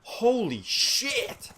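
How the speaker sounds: high-pitched, drawn-out shock; freaked out. Retold or imitated. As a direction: stretch it out slowly in a high panic. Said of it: "I'm freaking out".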